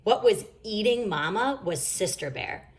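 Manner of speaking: usual speaking voice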